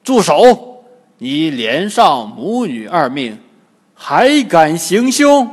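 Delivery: indignant shout; stern shouted rebuke. A narrator's reading. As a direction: bark out an outraged reprimand. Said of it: "被唐僧喝住".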